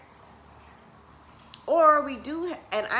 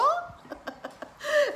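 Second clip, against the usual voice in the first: high, bubbling laugh; 4 dB louder than usual. Real, not acted. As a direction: burst into high giggles. Laughing while retelling his reply.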